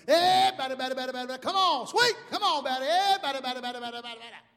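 high, loud infield heckle; upbeat baseball chatter. Acted. Third baseman yelling at the batter, upbeat and excited. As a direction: rattle it off like an excited ballplayer. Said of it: "he's just so upbeat and excited".

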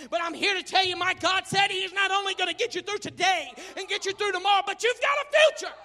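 loud, rising preacher's cry; fiery pulpit shout; preaching at full force. Acted. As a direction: preach it out to the congregation. Preacher climax.

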